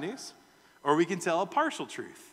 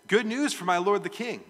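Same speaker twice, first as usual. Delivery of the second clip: louder and higher proclamation; announcing good news; 6 semitones higher, 7 dB louder than usual. Acted. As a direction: herald the news to the king. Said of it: "and the Cushite said".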